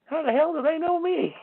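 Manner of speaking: how on earth do they know me; incredulous. Real, not acted. Venting about officials who know his name, incredulous.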